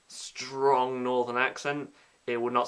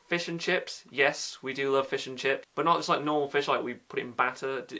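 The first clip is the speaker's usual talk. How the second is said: normal talking voice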